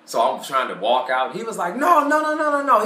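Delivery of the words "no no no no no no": high, loud string of no's; pleading salesman. Retold or imitated. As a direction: stop her at the door, high and urgent. Salesman stops her leaving.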